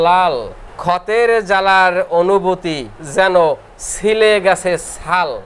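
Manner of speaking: drawn-out chanted rhyme, every word stretched; slow verse recitation. Acted. Teacher chanting a rhyme to students, slow and sing-song. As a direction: recite it like a memory verse for the class.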